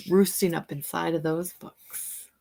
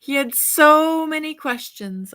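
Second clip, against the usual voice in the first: put-on bright, lively read-aloud for children; storybook reading; 6 dB louder than usual. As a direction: read it like a bedtime picture book to kids. Reads picture book aloud.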